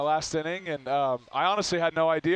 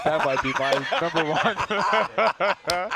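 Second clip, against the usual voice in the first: laughing; much slower than usual. Real, not acted. Laughing at memory.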